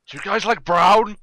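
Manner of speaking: loud, slow, high mock question; mocking shout. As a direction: bellow it out slowly, teasing.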